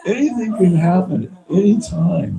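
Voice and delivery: ordinary talk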